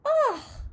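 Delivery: very high, loud disgusted ugh; disgusted gasp. A narrator's reading. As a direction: recoil from a nasty smell. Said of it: "he gasped".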